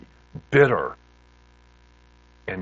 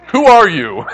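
loud, high, incredulous question; hammed-up stunned reaction with a laugh; laughing disbelief; 11 semitones higher, 17 dB louder than usual. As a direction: ask it amazed, through laughter. Reenacting disbelief at changed friend, laughing.

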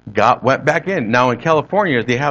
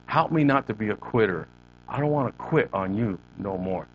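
lightly imitated prayer, low and soft; understated, low-voiced prayer; quiet prayer; 4 semitones lower, 7 dB quieter than usual. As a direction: repeat the plea softly, sinking at the end. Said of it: "what's your prayer?".

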